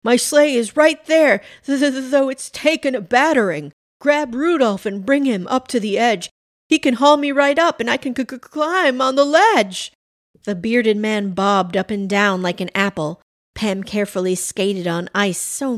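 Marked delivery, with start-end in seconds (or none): 0.0-9.9 high, louder, stammering with cold; teeth chattering. A narrator's reading. Santa in icy water begs for Rudolph, teeth chattering. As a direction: stutter through chattering teeth, urgently. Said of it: "teeth chattering".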